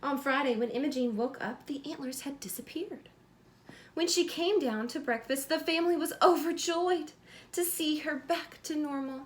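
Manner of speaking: bright, lively storybook reading; reading to children. A narrator's reading. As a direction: read it like bedtime story time for a little one. Reads picture book aloud.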